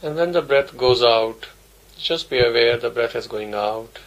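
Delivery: ordinary delivery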